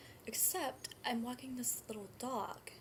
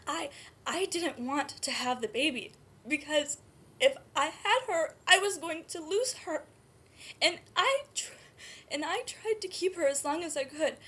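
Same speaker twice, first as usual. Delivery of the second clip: higher, louder, distraught outpouring; anguished; 4 semitones higher, 7 dB louder than usual. Acted. Birth mother tells Emily she tried to keep her baby, anguished. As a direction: let the desperation spill out loudly.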